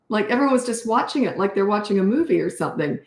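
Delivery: ordinary talk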